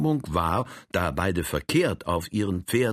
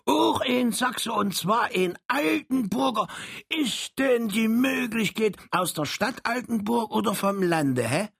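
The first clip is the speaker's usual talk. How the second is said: Saxon dialect. Acted. Little Saxon falls into his home dialect, high Saxon. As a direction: slip into broad Saxon, high. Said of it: "in seinen heimischen Dialekte ein".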